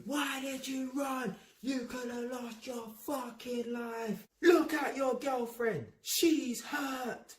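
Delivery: high, slow, strained telling-off without full volume; imitated scolding shout. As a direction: act out an angry officer berating a rider. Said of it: "he was just shouting at me".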